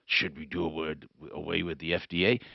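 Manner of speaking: imitating Deputy Doc. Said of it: "He says".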